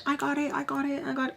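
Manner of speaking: high, soft, hurried offer; imitated eager admirer. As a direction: mimic someone sweetly insisting on paying. Imitates people eager to pay for him.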